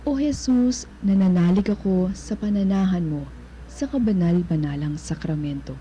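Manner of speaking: usual speaking voice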